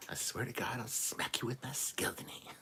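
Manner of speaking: high eerie whisper, imitating Sylvia; spooky whisper threat. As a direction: hiss a playful threat like a skeleton. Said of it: "I can't do it like she can".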